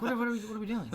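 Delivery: high, loud disbelieving laugh through the words; can't believe what's happening; laughing disbelief. Retold or imitated. Retelling the cousins' hunting trip, laughing disbelief.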